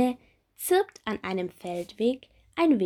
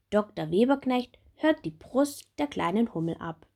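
ordinary delivery, nothing marked

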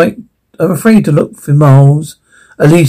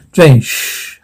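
ordinary talk

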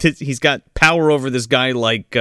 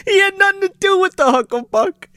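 put-on [name] caller. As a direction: squawk it like the prank caller.

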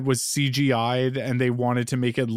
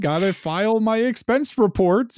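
apologetic employee voice; 7 semitones higher than usual. Retold or imitated. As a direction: plead you can't leave yet.

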